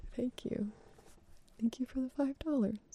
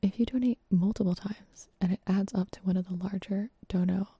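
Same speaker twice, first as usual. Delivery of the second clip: breathy close-mic whisper; 3 semitones lower than usual. Real, not acted. ASMR charity stream whisper.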